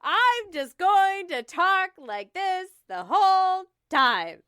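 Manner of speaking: high, slow, heavily beaten "I'm just going to talk like this the whole time"; attempted iambic pentameter. Shown as a demonstration. Host testing verse talk, exaggerated metrical beat. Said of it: "Is that iambic pentameter?".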